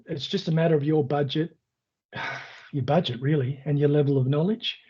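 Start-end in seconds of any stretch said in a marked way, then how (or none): none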